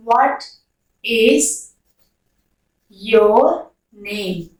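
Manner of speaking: spaced words with a falling end; one word at a time; word by word question. Shown as a demonstration. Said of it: "word-by-word question".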